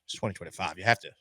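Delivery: soft, low Southern drawl; Southern lawyer drawl. Acted. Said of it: "Thank you, Southern Lawyer".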